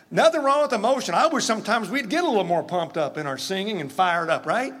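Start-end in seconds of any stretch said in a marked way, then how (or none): none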